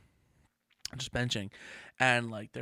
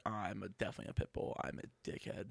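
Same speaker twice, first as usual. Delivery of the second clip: muttered; 5 semitones lower, 10 dB quieter than usual. Real, not acted. Self-deprecating aside, unvoiced.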